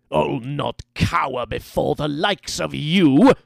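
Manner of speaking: loud, bristling aristocrat; defiant nobleman. A narrator's reading. Battered nobleman defies his captor, bristling and loud. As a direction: defy your captor at full voice, rising to the end. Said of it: "The nobleman bristled".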